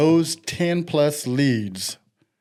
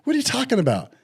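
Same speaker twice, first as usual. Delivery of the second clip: high, startled confusion; baffled; 10 semitones higher, 3 dB quieter, faster than usual. Retold or imitated. Answering friend's odd phone call, high baffled surprise. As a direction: sound caught off guard and confused.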